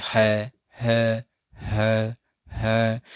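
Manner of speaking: repeated breathy है from the throat; h sound demo. Shown as a demonstration. Teacher leads class chanting है, airy. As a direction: breathe out है again and again. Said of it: "जैसे आप मेरे साथ बोलेंगे".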